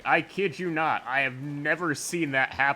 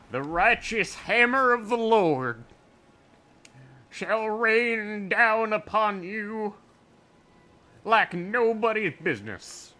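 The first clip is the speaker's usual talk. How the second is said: higher, louder fire-and-brimstone preacher; Southern Baptist preacher; 9 semitones higher, 3 dB louder, slower than usual. Acted. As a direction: preach it from the pulpit. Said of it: "my Southern Baptist preacher impression".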